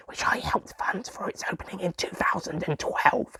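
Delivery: conspiratorial whisper; whispered aside. Acted. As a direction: let them in on a secret. Secretive aside about funding.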